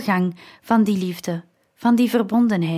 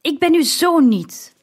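high, raised cry of disowning; angry shout; 7 semitones higher, 4 dB louder than usual. A narrator's reading. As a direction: cry out in furious rejection. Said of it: "schreeuwde Dionysius". (woedend uitgeroepen, met hoge stem)

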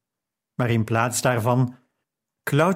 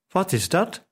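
lightly voiced curious little boy, higher pitch; higher-pitched child's question, lightly done; calling child; 6 semitones higher than usual. A narrator's reading. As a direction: call out the question like a small child, mildly. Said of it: "riep Arash".

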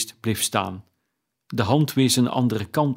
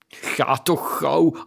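slow, higher, drawn-out scoff through a grin; grinning reply; 4 semitones higher, much slower than usual. A narrator's reading. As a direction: brush off the teasing with a grin, stretching the words. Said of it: "antwoordde Pieter grijnzend". (grijnzend, langgerekt)

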